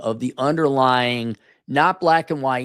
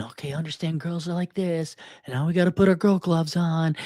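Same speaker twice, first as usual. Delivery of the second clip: slightly higher mock-instructor voice; mocking advice voice; 4 semitones higher than usual. Man mocking the book's advice, sing-song imitation. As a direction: lightly parody a patient explainer.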